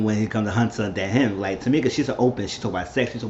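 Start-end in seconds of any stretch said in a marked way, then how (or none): none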